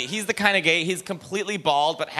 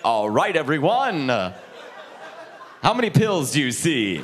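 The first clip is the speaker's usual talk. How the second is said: lower, slow mock-teacher drone; pharmacy teacher; 5 semitones lower, slower than usual. Imagined teacher quizzing pharmacy students, lower slow mock drone. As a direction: talk down like a patronizing instructor. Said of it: "your teacher's like".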